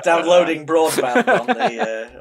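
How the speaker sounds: words broken by laughter; slow, giggling through the line; laughing. Real, not acted. Joke about bowel noise.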